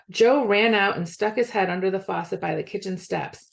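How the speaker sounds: ordinary delivery, nothing marked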